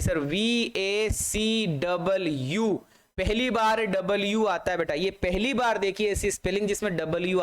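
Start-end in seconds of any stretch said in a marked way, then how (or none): 0.2-2.8 letters spelled out one at a time, very slowly; slow spelling. Shown as a demonstration. Teacher stressing the vacuum spelling, very slow. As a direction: spell it carefully so nobody misses the W. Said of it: "ये spelling खूब पूछी जाती है".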